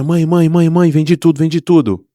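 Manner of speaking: high, loud, joyful calling; retold child's excited shout of success. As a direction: burst in calling mother triumphantly. Child running home excited.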